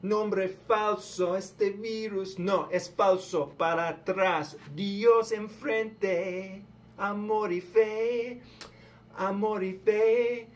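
high, loud, slow call to the faithful; fiery preaching. Acted. Vlogger urging viewers to cry God's name, in a high, loud preaching voice. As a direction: preach it out, stretching the key words.

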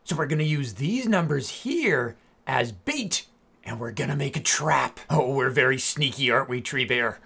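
ordinary talk, nothing marked

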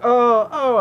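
high, loud mock "oh"; mock pained cry. The crow feigns hurt when shot, mock high yelp. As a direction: yelp in pretend pain. Mimics being shot in hand.

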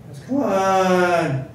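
exasperated plea. Retold or imitated. Student begs the pest behind him to stop, exasperated. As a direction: plead with exasperation, stretching the words. Teller acted as fed up.

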